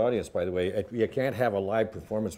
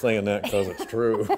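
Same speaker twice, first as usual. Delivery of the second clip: ordinary talk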